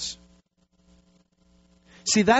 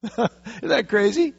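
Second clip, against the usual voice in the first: laughing disbelief; 6 semitones higher, slower than usual. Real, not acted. Laughing at Jonah.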